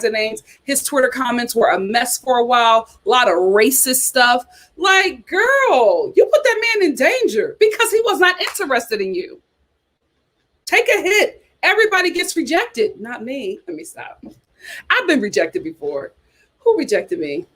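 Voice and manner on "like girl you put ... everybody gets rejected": sassy scolding. Real, not acted. Scolding the reality star directly.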